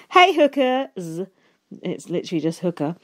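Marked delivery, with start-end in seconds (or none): 0.1-1.2 Jason Moore impression. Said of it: "That was my Jason Moore impression".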